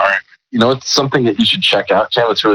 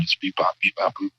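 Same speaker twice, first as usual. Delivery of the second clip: toy robot voice; 9 dB quieter than usual. Shown as a demonstration. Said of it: "A really cool person made the sound".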